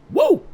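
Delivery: high-pitched "woo"; short squeal of pretend joy; mock celebratory whoop. Mock celebration of solved problems.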